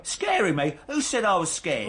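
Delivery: indignant, pitched high; bristling at being called scared. Acted. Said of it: "no need to raise your voice".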